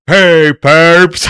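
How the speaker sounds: loud barked cop command, voice put on; Robocop voice. Comic panel Robocop bursting in, loud put-on cop bark. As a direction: bust in like a comic-book robot cop. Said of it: "Robocop breaks in and is like".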